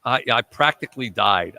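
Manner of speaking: usual speaking voice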